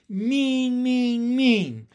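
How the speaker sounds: very high, loud, beat by beat; rhythmic yell. A narrator's reading. Ramona yells at her family, kicking the wall. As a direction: chant it in time with kicking heels. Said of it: "she yelled in time".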